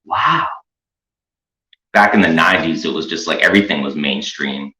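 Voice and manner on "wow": mock amazement. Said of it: "made people, like, go".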